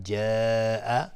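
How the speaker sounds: جاء with its long vowel held very long, slowly; long drawn-out جاء in Quranic recitation; elongated madd. Shown as a demonstration. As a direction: stretch the vowel of جاء for four to five beats. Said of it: "مد واجب متصل به مقدار چهار تا پنج حرکت".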